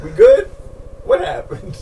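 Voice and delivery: high-pitched, probing questions; inquisitory. Retold or imitated. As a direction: imitate someone checking where they stand.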